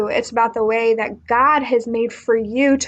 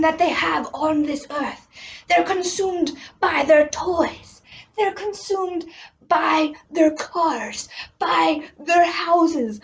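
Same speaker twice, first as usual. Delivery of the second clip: gentle Jesus voice; 4 semitones higher than usual. Acted. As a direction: speak as a kind teacher to his students. Acting Jesus teaching disciples.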